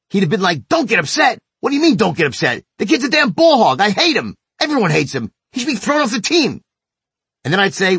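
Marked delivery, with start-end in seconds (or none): 0.7-6.6 mock furious kid, pitched higher; a son's indignant outburst, acted out; imitating angry kid. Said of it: "he would have gotten even madder".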